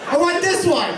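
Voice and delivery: loud, high comic exasperation; hammed-up cry of frustration; frustrated yell. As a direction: shout at the computer, fed up. Acted frustration fumbling with mouse.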